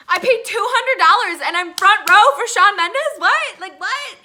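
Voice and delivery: loud, high-pitched incredulous outburst; ecstatic disbelief. Retold or imitated. Fan landing front row for a concert, ecstatic disbelief. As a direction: shout it, amazed at your luck.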